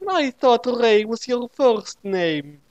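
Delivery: high, slow squeaky Ray voice; high voiced Ray. A narrator's reading. Ray questions Naomi, high slow squeaky voice. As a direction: drag it out in a high silly voice.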